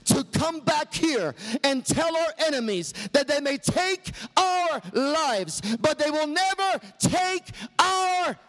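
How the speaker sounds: a hint of Braveheart rallying his men, rising to a high shout; Wallace battle speech. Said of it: "Mel Gibson ... addressing his band of brothers".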